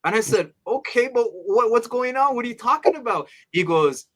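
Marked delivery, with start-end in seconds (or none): 0.7-3.3 high-pitched, puzzled questioning; retold baffled reaction; bewildered. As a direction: question a friend in confusion, pitched up. Retold bewildered reply to friend.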